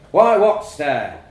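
loud, slow baffled dad; booming question. A narrator's reading. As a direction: boom the question slowly. Dad booms question at son.

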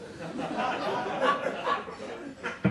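an airy burst of laughter mid-line; can't hold the laugh in; breathy laugh. Real, not acted. Reader breaks into laughter.

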